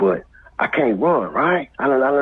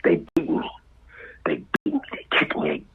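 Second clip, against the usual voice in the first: hushed retelling; 8 semitones lower, 4 dB quieter than usual. Retold or imitated. Retelling the beating.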